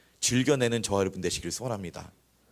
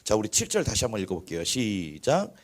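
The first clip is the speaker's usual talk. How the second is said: ordinary delivery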